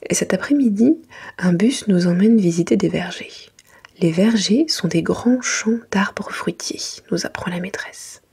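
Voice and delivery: usual speaking voice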